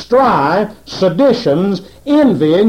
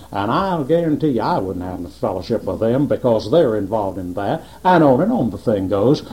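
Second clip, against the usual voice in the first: a hint of holier-than-thou boasting; mock pious voice; 7 semitones lower, 4 dB quieter than usual. Preacher mimicking pious folk, lower, quieter and smug. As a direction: lower and softer, smug self-righteous churchgoer. Mocking pious self-righteous people.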